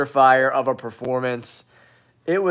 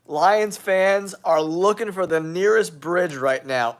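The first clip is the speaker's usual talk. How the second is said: normal talking voice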